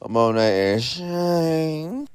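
drawn-out announcer call; runway announcer. Acted. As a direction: stretch the name like a host. Host announces next queen.

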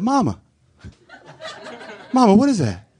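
put-on high, wide-eyed little boy; excited child. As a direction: call out to mama in wonder. Child voice in retold story.

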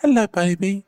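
very high cooing greeting; high baby voice greeting a dog; baby talk. Shown as a demonstration. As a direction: coo to the puppy. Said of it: "showing how to talk to the dog".

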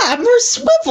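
excited. Real, not acted. Excited repeat of swivel.

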